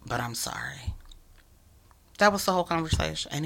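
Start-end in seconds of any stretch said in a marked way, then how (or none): 0.1-0.9 soft apology. Retold or imitated. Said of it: "And he said".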